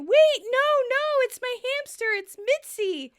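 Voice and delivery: high, loud, urgent plea; hammed-up alarmed cry; panicked shout. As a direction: shout to stop them, acting frantic. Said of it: "[name] starts shouting".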